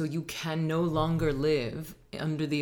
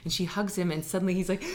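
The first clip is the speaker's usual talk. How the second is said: ordinary delivery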